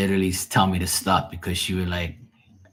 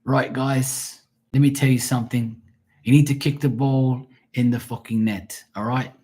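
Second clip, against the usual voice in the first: higher-pitched Scottish soccer coach; put-on Scottish accent, urging the players; Scottish dad accent; 5 semitones higher, 3 dB louder than usual. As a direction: imitate a Scottish dad instructing his team. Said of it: "my friend's dad's from Scotland".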